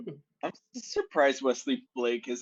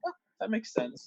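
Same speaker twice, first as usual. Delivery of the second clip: lightly acted reaction in a much higher pitch; high, fairly quiet quoted realization; quoted own reaction; 9 semitones higher, 4 dB quieter than usual. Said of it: "I'm like".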